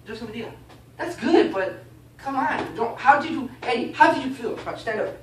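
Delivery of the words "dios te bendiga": soft, token hello; half-hearted, higher and softer greeting; perfunctory greeting. Shown as a demonstration. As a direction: toss off a polite blessing quietly. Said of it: "don't just say".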